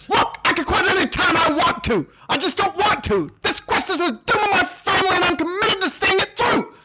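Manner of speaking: stubborn knight defending his quest. Acted.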